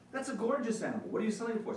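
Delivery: a buyer who can't believe his luck; excited buyer. Retold or imitated. Buyer to farmer while eyeing the horse, excited.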